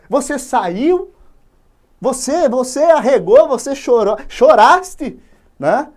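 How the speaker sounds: loud, high, slow scolding; scolding father. Acted. Father scolding his son for crying. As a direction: tear into the son for his shame. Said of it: "O pai esculacha o moleque".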